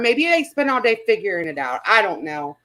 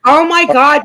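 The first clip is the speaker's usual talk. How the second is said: loud, higher burst of disbelief; shocked outburst; 4 semitones higher, 10 dB louder than usual. Real, not acted. Streamer reacting to a chat comment, loud shocked gasp. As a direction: blurt it out, stunned.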